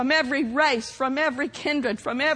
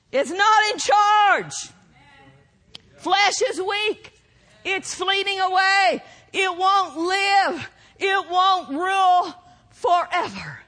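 ringing, drawn-out sermon delivery; full-force preaching; 6 semitones higher, 5 dB louder, slower than usual. Real, not acted. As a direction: proclaim it to the congregation with mounting fervour.

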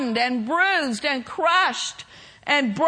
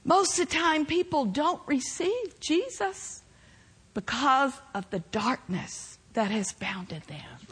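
lower, softer preaching, drawing them in; soft intimate preaching; 7 semitones lower, 7 dB quieter than usual. Real, not acted. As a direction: hush it down and plead gently.

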